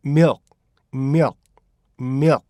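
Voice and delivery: milk cut off before the k is released; swallowed final k. Shown as a demonstration. Teacher shows the k changing inside sentences, unreleased k. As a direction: say "milk" as "myuk", stopping short on the k. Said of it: "이렇게 되는 거죠".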